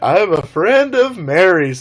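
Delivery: mock solemn stranger. Stranger in the boat answering screams, slow exaggerated declaration. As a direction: draw it out in a hammed-up declaration.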